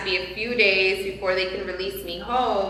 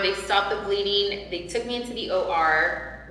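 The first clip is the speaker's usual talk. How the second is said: ordinary delivery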